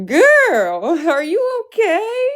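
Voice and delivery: high, slow mocking "are you okay"; mocking girl voice. Mocking the woman on her video, high and drawn out. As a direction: squeal it like a catty friend. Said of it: "and then went".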